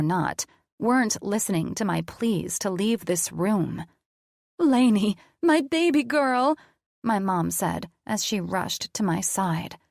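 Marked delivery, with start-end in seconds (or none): 4.6-6.6 high, loud worried mother calling out; a frightened mom rushing in; anxious mother. A narrator's reading. Mother rushes to daughter.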